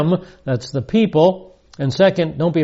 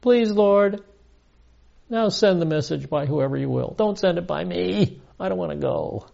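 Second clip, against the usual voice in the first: pleading Moses; 4 semitones higher, 4 dB quieter than usual. Retold or imitated. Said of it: "Moses, but he said".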